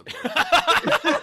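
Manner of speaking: slow laughing. Real, not acted. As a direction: laugh through it. Laughing end of remark.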